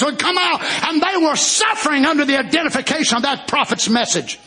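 full-force Pentecostal preaching; fiery preaching. Real, not acted.

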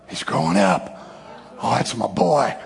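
low, hushed and moved; tender fatherly pride. Acted. God watching his boy forgive, tender pride. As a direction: murmur it low, full of pride.

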